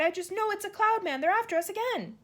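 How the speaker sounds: high, frightened cry. A narrator's reading. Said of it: "Miss Spider cried".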